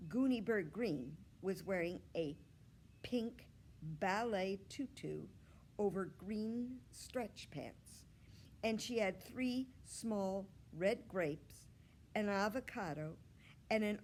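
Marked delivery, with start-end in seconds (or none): none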